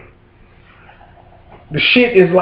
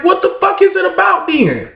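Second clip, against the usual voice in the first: high outraged shout; 12 semitones higher than usual. Retold or imitated. Father demanding an answer from the officials, shouting high. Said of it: "I'm like".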